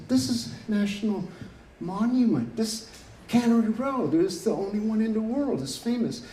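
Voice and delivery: retold indignant plea. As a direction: plead for the place like it matters. Retold defence of Cannery Row.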